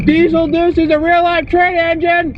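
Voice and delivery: loud, high, drawn-out shout; startling high-pitched yell. Real, not acted. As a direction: belt it out slowly at the top of your voice. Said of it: "don't shout while I'm driving".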